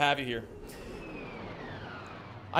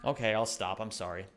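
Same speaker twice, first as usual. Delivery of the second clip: ordinary delivery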